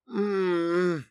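high sleepy "mmm"; drawn-out sleepy moan. Acted. Sleeping blonde being woken, drawn-out moan. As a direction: drag out a high, drowsy hum. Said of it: "протянула блондинка".

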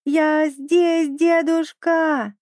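put-on high, stretched-out childlike call; shouting. A narrator's reading. Little penguin calls grandfather from drifting ice, shouting. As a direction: call slowly across a great distance. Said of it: "закричал Пик изо всех сил".

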